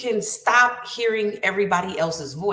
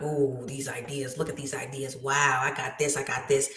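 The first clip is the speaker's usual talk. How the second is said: delighted murmur; 7 semitones lower, 5 dB quieter than usual. Retold or imitated. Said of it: "You're gonna be like".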